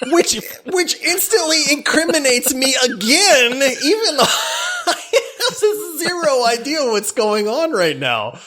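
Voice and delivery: real laughter, voice breaking high; laughing. Real, not acted. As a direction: burst into laughter mid-sentence. Laughing at his own story.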